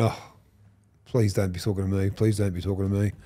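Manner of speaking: low, soft plea to himself; imitating a private prayer to be left alone; retold quiet dread. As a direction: say it small and low, hoping not to be noticed. Dreads the opponent addressing him.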